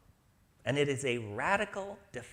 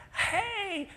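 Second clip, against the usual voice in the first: cheery hey. Retold or imitated. Greeting someone who forgot resentment.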